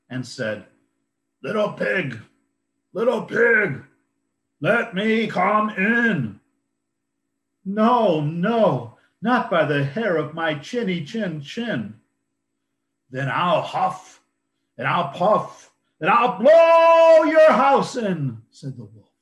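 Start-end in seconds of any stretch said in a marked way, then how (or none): 1.4-6.4 big bad wolf calling sweetly; wolf asking to be let in; werewolf. Wolf voice in story. 7.6-12.0 loud squeaky refusal; frightened pig yelling no; little pig shouting. Acted. 13.1-18.4 loud threatening wolf; big bad wolf roaring threat; werewolf.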